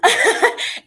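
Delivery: breathy delighted laugh; happy laugh. Real, not acted. Recalling her big win with a laugh. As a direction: laugh with remembered joy. Asked how the win felt.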